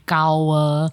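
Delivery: "kawa", slow, the F sound replaced by K; childish "kawa" for flower; speech impediment. Retold or imitated. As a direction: say "kawa" slowly like a child who can't say F. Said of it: "Lily had a speech impediment".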